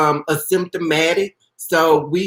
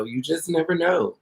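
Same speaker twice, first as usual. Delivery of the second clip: ordinary talk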